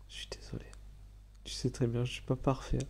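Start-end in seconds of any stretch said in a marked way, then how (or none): 0.1-0.6 breathy whisper, barely audible; whispered apology. Real, not acted. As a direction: whisper the sorry like a secret. Répète l'excuse en chuchotant. (excuse chuchotée tout bas)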